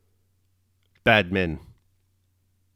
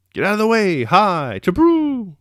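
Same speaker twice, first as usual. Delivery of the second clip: high driver's holler, last word held long; shouting; 11 semitones higher, 5 dB louder than usual. A narrator's reading. Balaga clearing the street for his troika, shouting. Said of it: "The shouting of Balaga".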